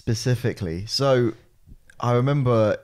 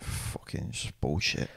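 glum driver; 9 semitones lower, 11 dB quieter than usual. Retold or imitated. Driver voiced as miserable, grumbling.

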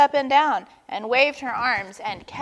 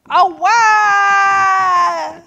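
high, loud, long mock wail; drawn-out tantrum wail; 10 semitones higher, 10 dB louder than usual. A narrator's reading. Walrus joins the fake tantrum, wailing. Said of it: "wailed Walrus".

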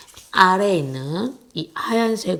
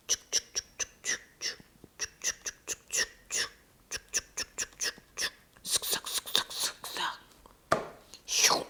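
mouth sound effects; 18 dB quieter than usual. Retold or imitated. Imitates gluing/cutting sounds.

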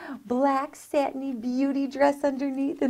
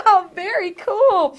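laughing; 8 semitones higher, 9 dB louder than usual. Real, not acted. Laughs after reading spooky verse.

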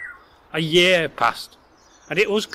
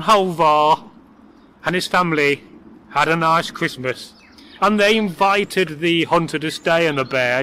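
ordinary delivery

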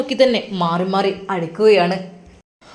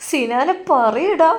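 high, slow wailing പറയടാ; mother wails at Sinan to tell, high and drawn out; crying plea; 7 semitones higher, much slower than usual. Acted. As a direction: beg her son slowly, voice pitched high in tears. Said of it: "ഉമ്മ അവിടെ നിന്ന് ഉറക്കെ കരയുകയാണ്".

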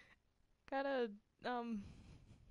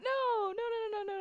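mock crying; 14 semitones higher, 6 dB louder than usual. Pleading after offending joke.

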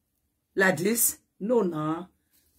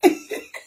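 sudden high squeal of laughter; shrieking laugh; 3 dB louder than usual. Real, not acted. Laughing at the clip.

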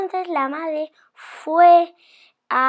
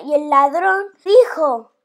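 ordinary delivery

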